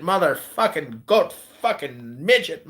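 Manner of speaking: normal talking voice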